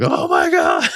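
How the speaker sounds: high-pitched re-enacted scream of disbelief; hammed-up shriek, far above normal pitch. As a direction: yell it out as if at the top of your lungs. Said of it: "screaming at the top of my lungs".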